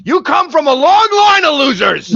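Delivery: high, loud roar of mock outrage; performed full-volume fatherly tirade; hammed-up dad's yell. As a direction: bellow it at your son, overplayed. Dad yelling back at son.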